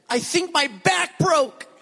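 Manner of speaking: high, slow pained wife's voice; imitating wife in pain. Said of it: "And she said".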